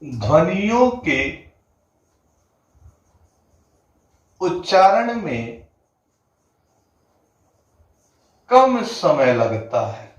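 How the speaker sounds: each phrase spoken slowly with long pauses between, like dictating; slow it right down so students can write ध्वनियों के उच्चारण में कम समय लगता है; slow dictation. Shown as a demonstration. Hindi teacher dictating the definition to students, slow and spaced. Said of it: "क्या बोला गया?".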